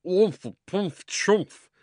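high, loud muffled grunts; muffled under a hat. A narrator's reading. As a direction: splutter through a hat over your face. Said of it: "voice from inside the hat".

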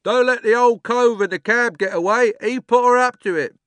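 high, loud shout from the crowd; calling out. A narrator's reading. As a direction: yell a warning over the noise. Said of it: "someone else called out".

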